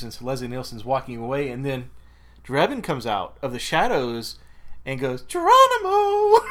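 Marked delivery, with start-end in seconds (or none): 5.2-6.5 loud, very high, drawn-out cry; stretched-out, piercing mock call; high-pitched weird voice. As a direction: imitate a creepy villain's high holler. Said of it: "this really high-pitched, weird voice".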